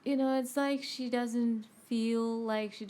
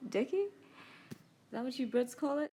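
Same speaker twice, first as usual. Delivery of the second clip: usual speaking voice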